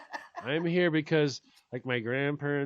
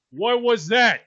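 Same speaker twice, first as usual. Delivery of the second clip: louder demanding question; father scolding the girl, loud; mock scolding; 5 semitones higher, 9 dB louder than usual. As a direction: demand an answer, sharp and loud. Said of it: "he looks and goes".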